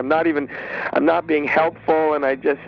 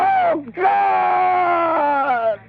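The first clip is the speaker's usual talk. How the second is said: high, drawn-out wail of grief; hammed-up grief-stricken cry; anguished wail; 12 semitones higher than usual. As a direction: cry out to heaven, voice soaring high. Caller wails over brother's death.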